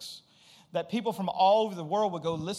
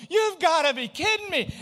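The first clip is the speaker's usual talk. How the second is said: higher, louder cry of exasperation; exasperated yell; 8 semitones higher, 5 dB louder than usual. Retold or imitated. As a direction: throw it up at the sky, higher and louder. Hail pouring down on raft.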